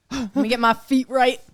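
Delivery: loud, slow psyching-up; hyped up. Real, not acted. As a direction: gasp then psych yourself up. Nervous before the guess.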